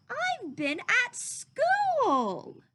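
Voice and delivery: put-on a child stating the obvious, slowly; boy's voice. Christopher Robin explains.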